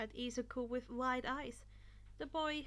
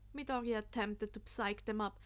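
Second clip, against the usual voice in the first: ordinary delivery, nothing marked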